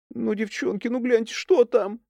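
high, whiny begging; whiny pleading. Acted. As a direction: whine it like a scared child. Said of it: "плаксиво клянчила". (плаксиво, клянча)

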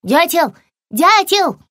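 high, rising-intensity little lizard call; high-pitched cry for attention. A narrator's reading. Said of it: "закричала она".

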